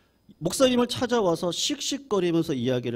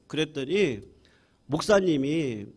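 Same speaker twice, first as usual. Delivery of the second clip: normal talking voice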